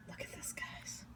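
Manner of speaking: soft breathy whisper of awe. Real, not acted. Cook showing the bowl to viewers, awed whisper. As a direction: whisper it like a secret treasure.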